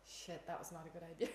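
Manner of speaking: low, soft, deadpan afterthought; underplay it as a too-late realisation; mock rueful regret. Acts out dying person's late regret.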